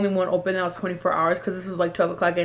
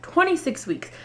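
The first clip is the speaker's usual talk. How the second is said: high, falling twenty-six weeks in disbelief; shocked exclaim; 9 semitones higher than usual. Retold or imitated. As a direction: cry out the number in shock. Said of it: "this woman tells me".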